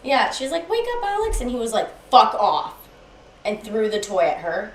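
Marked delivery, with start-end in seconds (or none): 0.7-1.4 very high-pitched child imitation; small girl calling. Six-year-old waking a sleeping man, childlike call. 2.1-2.7 loud, gruff imitation; retold angry swearing. Boyfriend woken by a child, angrily swearing. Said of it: "swearing at me".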